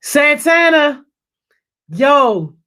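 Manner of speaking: loud, high, stretched-out name; calling out. Real, not acted. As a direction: holler the name with pride, slowly. Calling out a praised viewer's name.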